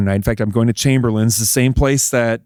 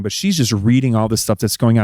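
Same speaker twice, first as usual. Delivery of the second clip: ordinary talk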